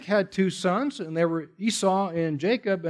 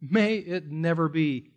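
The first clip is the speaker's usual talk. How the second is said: ordinary talk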